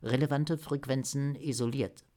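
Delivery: lightly voiced computer assistant; Swaki. A narrator's reading. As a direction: report like an AI completing a task. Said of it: "Die künstliche Intelligenz ... antwortete prompt". (künstliche Intelligenz, leicht angedeutet)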